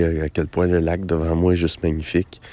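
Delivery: normal talking voice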